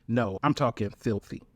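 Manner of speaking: ordinary delivery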